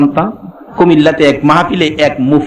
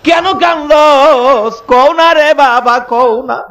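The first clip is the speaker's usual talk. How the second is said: higher, slow, drawn-out pleading call; mother pleading; 8 semitones higher, slower than usual. Retold or imitated. Mother begging her son to say why he cries, slow high plea. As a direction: coax the child tenderly, stretching every word. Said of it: "এরপরে ও ডাকে".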